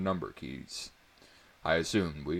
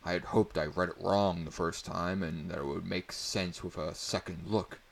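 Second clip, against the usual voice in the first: plain reading voice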